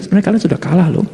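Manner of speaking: normal talking voice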